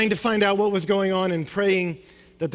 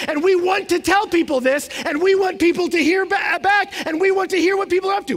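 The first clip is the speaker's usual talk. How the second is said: excited; 7 semitones higher than usual. Acted. Excited riff on constant communication.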